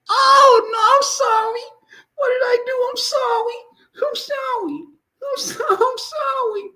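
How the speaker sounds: very high, squealed apology; mock pleading. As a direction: beg for mercy in a squeaky falsetto. Acting out victim begging forgiveness.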